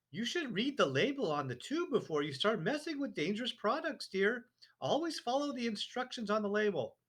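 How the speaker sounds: high, prim wifely voice; a fussy wife brushing him off; wife's voice. A narrator's reading. Wife dismissing husband's hat trouble, high and prim. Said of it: "Missus Wormwood said to him".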